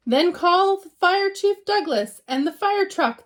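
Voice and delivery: high, loud frantic shout. Acted. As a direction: call out urgently for the fire chief. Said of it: "the farmer shouted".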